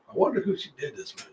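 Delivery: hushed, voiceless whisper; acted secret aside under the breath; private thought. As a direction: whisper it as if thinking to yourself. Acted private wondering to himself.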